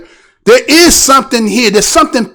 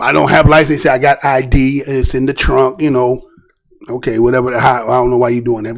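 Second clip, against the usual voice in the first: low mocking officer voice; 10 semitones lower, 4 dB quieter than usual. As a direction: drop the voice low to mimic him. Retelling officer and driver exchange.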